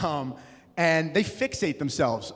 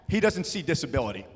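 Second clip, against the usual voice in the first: usual speaking voice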